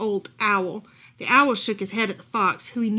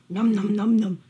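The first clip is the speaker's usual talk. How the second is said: munching; 4 dB louder than usual. Acted. Eating sound.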